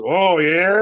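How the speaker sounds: loud drawn-out challenge, pitch rising; retold challenge.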